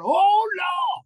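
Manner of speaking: high, loud hammed-up wail of Oh Lord; joking wail of a husband after fifty years; mock cry to the Lord. Voicing husband crying out.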